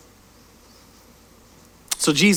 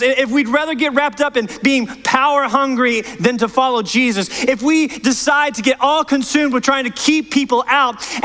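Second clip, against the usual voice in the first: raised, driving sermon voice, pressing each point; impassioned preaching; 6 semitones higher than usual. Acted. Pastor urging the church to follow Jesus, impassioned preaching. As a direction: preach it out to the whole congregation.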